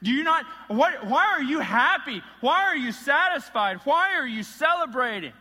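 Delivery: loud, high outraged questions at his father; angry son. Retold or imitated. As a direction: demand why Dad is celebrating. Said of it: "his response is one of anger".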